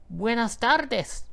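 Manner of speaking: high, slow squawky parrot greeting; be the parrot saying hello. A narrator's reading. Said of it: "he says".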